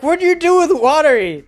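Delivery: high-pitched angry accusation; mock furious. L confronting Yagami about missing Watari, mock furious. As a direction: act out an outraged interrogation. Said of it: "L gets really mad".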